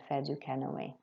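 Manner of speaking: "canoë" ending in a clear "ay" sound; accented final A sound. Shown as a demonstration. Teacher explaining the accent to pupils, "canoë" with a clear final "ay". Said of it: "make our voice go up on the A sound".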